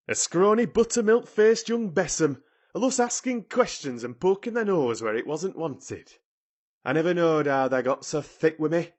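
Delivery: indignant scolding in broad dialect; haranguing. A narrator's reading. Ben berating Mary from the ladder, haranguing. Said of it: "he harangued".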